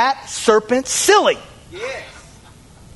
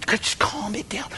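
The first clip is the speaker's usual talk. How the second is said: very soft mock soothing; hushed calming; 10 dB quieter than usual. People soothing away their sin, hushed. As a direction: hush it right down. Quoting soothing excuse before shouted rebuttal.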